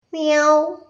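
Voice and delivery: imitated kitten's cry, rising at the end; voiced little cat mew; kitten meowing. A narrator's reading. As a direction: meow like a crying kitten. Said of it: "കുഞ്ഞിപ്പൂച്ചയുടെ കരച്ചിലല്ലേ കേൾക്കുന്നത്".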